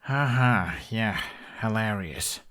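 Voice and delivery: flat, slow fake laugh; deadpan it, unamused. Hiccup to the laughing boy, a deadpan fake laugh.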